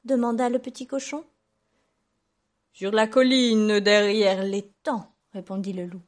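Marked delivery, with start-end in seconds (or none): 2.7-5.1 loud, slow drawn-out wolf; luring wolf. A narrator's reading. Wolf telling the pig where the tree is, luring. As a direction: stretch the directions out temptingly.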